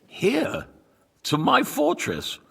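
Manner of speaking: sharp, high disbelieving question; alarmed surprise. Acted. Lord hearing troops head for his fortress, alarmed and high. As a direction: caught off guard by the danger, voice jumping up. Lord hears enemies march on him.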